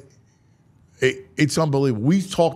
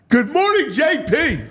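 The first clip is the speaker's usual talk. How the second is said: hyped greeting; 12 semitones higher than usual. Acted. Show greeting called out high.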